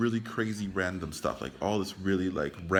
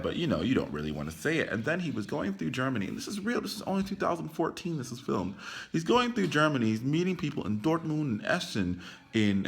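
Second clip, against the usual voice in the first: normal talking voice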